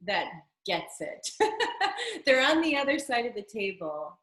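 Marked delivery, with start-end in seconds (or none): 1.3-2.2 light high chuckle; a quick amused laugh; short high laugh. Real, not acted. Laughs after explaining a director.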